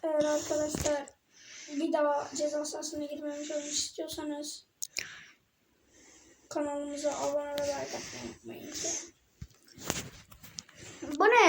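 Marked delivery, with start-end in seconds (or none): 0.0-8.7 soft whisper. Real, not acted. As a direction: whisper the subscribe plea. Subscribe plea whispered.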